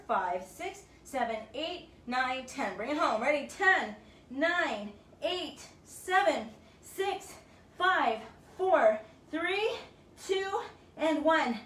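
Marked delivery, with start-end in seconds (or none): 3.5-11.6 higher and louder, slowing to a big finish; triumphant closing countdown; shouted final countdown. Real, not acted. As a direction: drive them through the last reps.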